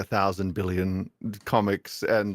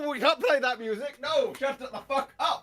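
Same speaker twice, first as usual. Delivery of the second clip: angry yelling. Real, not acted. Yelling at music playing.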